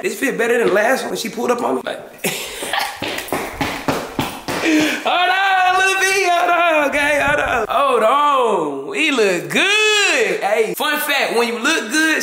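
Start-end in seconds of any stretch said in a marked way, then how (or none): 2.0-5.0 breathy burst of laughter; long wordless giggle; laughing. Real, not acted. Cracks up at own outfit. 5.0-10.4 high, excited self-hype; thrilled, high-pitched gushing over the look; hyped up. Real, not acted. Hyping up his fresh outfit.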